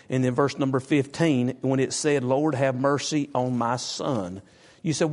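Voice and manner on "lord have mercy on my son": slow quoted plea; slow, deliberate scripture quote; slow, weighty reading. Shown as a demonstration. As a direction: draw the verse out slowly, word by word. Preacher re-reading the verse slowly.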